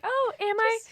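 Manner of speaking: high-pitched fake-modest question; mock coy surprise. Voicing co-host's coy reply to praise.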